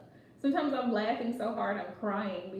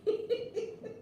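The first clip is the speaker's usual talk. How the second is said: breathy giggle up high; a quick high giggle; high laughter; 10 semitones higher, 4 dB quieter than usual. Real, not acted. Host amused by Tyler's antics, giggling high.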